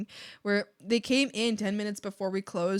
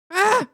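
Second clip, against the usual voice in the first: short, high, loud cry; sudden yelp; 10 semitones higher, 13 dB louder than usual. Acted. Acts being shot.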